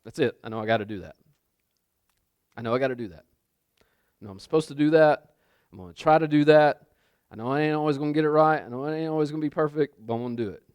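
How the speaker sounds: low, subdued self-talk; humble, understated determination; quiet resolve. Acted. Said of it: "and you're like".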